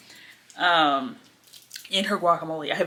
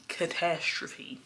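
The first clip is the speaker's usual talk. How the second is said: ordinary talk, nothing marked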